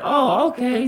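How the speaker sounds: high-pitched mock acceptance; imitated knowing okay; ominous granny. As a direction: answer as a granny plotting punishment.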